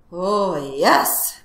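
loud, relishing exclamation; triumphant storybook fox; gleeful cry. Acted. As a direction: crow it like a delighted fox. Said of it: "cried the fox".

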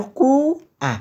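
কু held long and high, then a separate আ; two separate beats. Shown as a demonstration. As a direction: stretch কু high, break, then land on আ. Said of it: "দুইবার উচ্চারণ হয়েছে". (কু টেনে উঁচু স্বরে, তারপর আলাদা করে আ)

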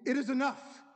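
high, loud divine command; commanding proclamation. Acted. The Lord halting the destroying angel, loud command. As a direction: proclaim it forcefully as the Lord's order. God's command in read-aloud scripture.

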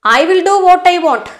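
loud, high, furious retort; angry roar. Retold or imitated. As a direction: shout him down in a rage. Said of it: "Ajit roars out". (ദേഷ്യത്തോടെ അലറൽ)

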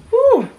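high sharp exhale whew; burning mouth whew. Real, not acted. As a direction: blow out the burn, loud and high. Exhale after hot chili bite.

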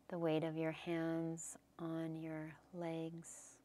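soft, settling meditation voice with long pauses; guided meditation. Real, not acted. Yoga teacher settling the class to notice breath, guided meditation.

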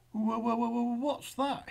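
high, stammered fright; quivering stutter. A narrator's reading. Boo points at something with fear, quivering stutter. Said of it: "stopped with a quiver".

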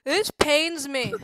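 slow, drawn-out suffering; slow pained groan. Real, not acted. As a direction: drag each word out in pain. Suffering through spicy chips.

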